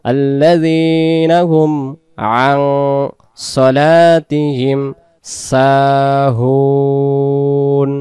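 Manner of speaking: slow melodic Quran reading; tajweed chant; slow recitation. Acted. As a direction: recite calmly, stretching the ends. Recites verse slowly.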